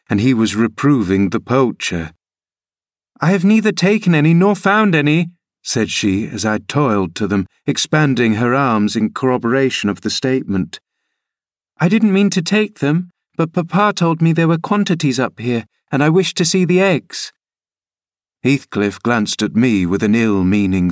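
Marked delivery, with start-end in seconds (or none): 3.2-5.3 put-on higher girlish voice, bossy and bright; a girl urging her nurse uphill; eager young Cathy. Cathy denies poaching. 11.8-17.3 put-on higher girlish voice, bossy and bright; a girl urging her nurse uphill; eager young Cathy. Cathy explains to Heathcliff.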